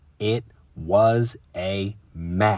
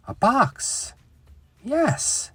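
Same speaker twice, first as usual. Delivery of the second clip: lightbulb idea; much slower than usual. Acted. Papa's idea.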